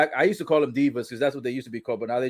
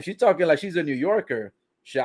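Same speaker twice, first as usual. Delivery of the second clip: usual speaking voice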